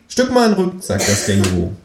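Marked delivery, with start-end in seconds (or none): 0.1-0.8 high, cheeky kangaroo voice; high, sulky kangaroo. Acted. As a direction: play the kangaroo, much higher than the narrator. Kangaroo's voice in the story.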